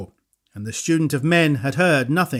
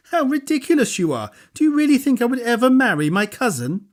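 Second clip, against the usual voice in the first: put-on higher, amused young woman's voice; laughing it off, young woman; 8 semitones higher than usual. A narrator's reading. Girl laughs at the idea.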